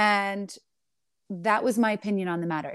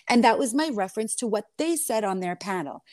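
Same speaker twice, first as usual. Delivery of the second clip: normal talking voice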